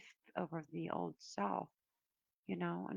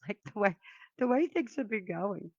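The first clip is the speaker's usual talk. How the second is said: laughing; 3 semitones higher, 10 dB louder than usual. Real, not acted. Laughs at bad luck with deck.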